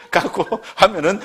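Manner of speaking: breaking into laughter mid-sentence; amused laugh interrupting the phrase. Real, not acted. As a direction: chuckle through the words, then carry on.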